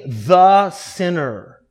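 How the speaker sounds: "the" drawn out slowly and leaned on before sinner; stress on THE. Shown as a demonstration. Preacher stressing "the" in the tax collector's prayer. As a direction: hold and press "the". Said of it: "Definite article".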